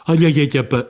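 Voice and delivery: playful imitated baby talk; mirroring baby babble. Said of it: "you're matching their tone".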